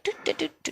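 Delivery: high sing-song doot doot. Retold or imitated. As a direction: hum a high happy doot doot. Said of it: "you're like".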